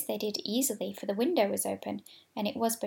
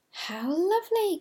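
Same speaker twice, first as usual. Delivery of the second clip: high, slow, delighted cry; excited cry; 9 semitones higher, 3 dB louder, slower than usual. A narrator's reading. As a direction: gasp it out with delight. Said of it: "cried all the dolls".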